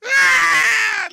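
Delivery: a sudden high wailing shout; imitated wailing cry. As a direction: burst out in a loud crying wail. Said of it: "y le hacía así".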